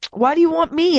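put-on boy screaming after the thugs. A narrator's reading. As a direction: shout after them in panic. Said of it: "I screamed after them".

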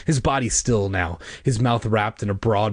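usual speaking voice

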